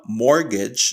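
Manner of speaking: MORTGAGE with a silent T, "MOR-gej"; correct pronunciation. Shown as a demonstration. Teacher naming the word before correcting a mistake, correct silent-T mortgage. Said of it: "is not pronounced as".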